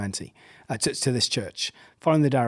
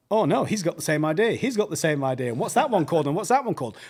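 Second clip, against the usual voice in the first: higher, louder flustered worrying; mock inner panic; 5 semitones higher, 4 dB louder than usual. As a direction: fret aloud in comic alarm. Retold inner panic about rivals.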